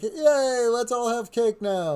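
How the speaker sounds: high chirpy party voice; oblivious hostess cheer; mock cheerful. Wife cheerfully brings in cake.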